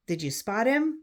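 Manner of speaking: plain reading voice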